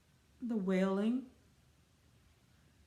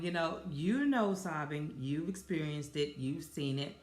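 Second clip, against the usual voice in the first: normal talking voice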